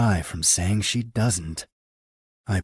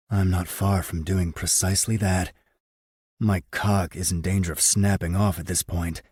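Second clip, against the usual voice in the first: ordinary delivery, nothing marked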